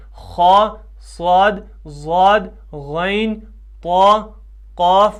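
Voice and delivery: خا through قاف each full and rounded; emphatic recital from خا to قاف; thick letters. Shown as a demonstration. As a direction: list خا, صاد, ضاد, غین, طا, قاف one by one, heavily. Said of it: "پر حروف".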